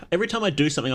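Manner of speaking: ordinary talk, nothing marked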